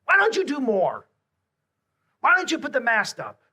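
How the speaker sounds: high, loud, hammed-up scolding; a joking Jesus scolding his disciples, overdone; mock scolding. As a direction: wag the bony finger at them. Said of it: "he gives him the bony finger".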